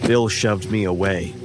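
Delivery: plain reading voice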